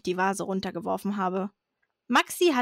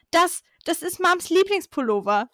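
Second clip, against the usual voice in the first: higher-pitched, only mildly raised yell; narrated shriek; 7 semitones higher than usual. A narrator's reading. Girl yells at sister over Mum's favourite pullover, mild shriek. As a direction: cry out in high-pitched alarm, not full volume. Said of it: "schrie ich".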